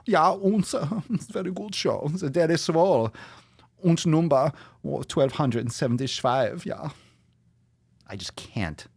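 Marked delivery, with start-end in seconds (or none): 0.1-6.9 high, hammed-up camp German accent. Host as German guy announcing the episode, camp German accent.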